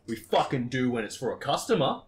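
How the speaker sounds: loud, hard comeback; retold firm retort. To the manager at the pallet, loud firm retort. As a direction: shut him down firmly.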